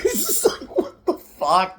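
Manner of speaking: can't get through it for laughing; laughing disbelief. Real, not acted. Reacting to a crude movie gag, real laughter.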